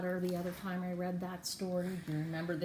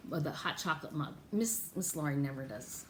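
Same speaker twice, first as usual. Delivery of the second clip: plain reading voice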